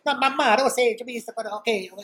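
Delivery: high-pitched imitated protest to mamma; Italian son phoning mamma. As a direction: plead back and forth, mock-Italian. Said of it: "Go back and forth".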